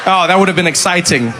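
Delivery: normal talking voice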